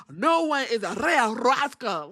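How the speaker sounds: imitating Asuka, rolling the R in "Rhea"; rolled R. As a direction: roll the R hard in "Rhea Asuka". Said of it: "she said it with rolling her tongue".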